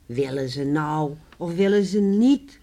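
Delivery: loud, pressing 'will they or won't they'; exasperated question. Acted. Neighbours retell their own impatient question.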